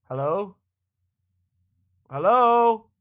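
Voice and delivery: high, loud call into the mic; shouting hello to get an answer. Real, not acted. Calling friend, no reply.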